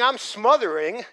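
usual speaking voice

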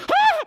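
high, loud retold scream of a frightened boy; mock scream. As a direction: let out a child's terrified yell. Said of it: "I would scream and scream".

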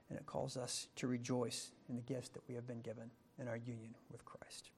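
hushed, unvoiced whisper. Real, not acted. Preacher closing the sermon before prayer, whispering. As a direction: drop to a whisper to close.

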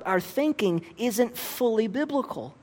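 ordinary talk

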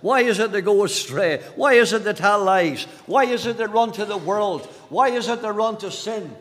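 insistent run of preacher's questions; impassioned preaching. Real, not acted. Preacher asking the congregation why people sin, impassioned. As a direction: press each question home from the pulpit.

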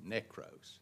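nekros said softly as NEK-ros; soft careful Greek nekros. Shown as a demonstration. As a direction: pronounce nekros quietly and carefully, stress on NEK. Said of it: "I can pronounce that one".

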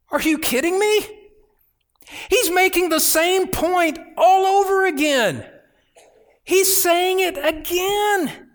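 amazed that Paul says it again; incredulous outburst. Real, not acted. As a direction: burst out in disbelief. Preacher's astonished outburst.

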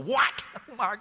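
high, fast, laughing mock panic; mock startled Israelites. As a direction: play the caught grumblers, squeaking in surprise. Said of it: "Don't you know they will".

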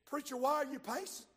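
lightly voiced worried deacon; light imitation of a deacon; deacon asking. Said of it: "One of the deacons says".

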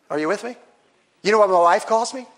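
ordinary talk, nothing marked

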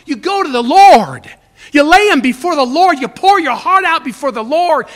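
loud, high urging; fervent preaching. Real, not acted. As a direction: preach it out with full force. Preacher urging at full force.

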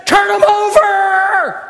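triumphant order to death and Hades; commanding victor. Acted. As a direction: demand the keys like a conqueror. Victor demands keys from death.

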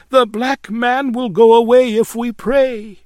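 high, loud, urgent plea; crying out. A narrator's reading. Terrified lodger urging her landlady to pray, crying out. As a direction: keep crying it out, pitched high. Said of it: "cried Ellen".

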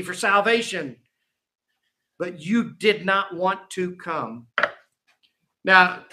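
ordinary talk, nothing marked

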